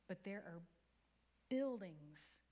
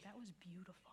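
soft whispered praise; whispered aside; 8 dB quieter than usual. Retold or imitated. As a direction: breathe it out under the breath. Pastor friend whispers at the table.

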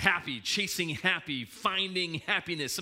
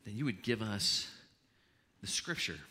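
soft, hushed pastor's prayer; quiet prayer; 8 semitones lower, 7 dB quieter than usual. Acted. Opening prayer.

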